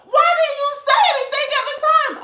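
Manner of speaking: dramatic lady. Retold or imitated. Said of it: "she's like".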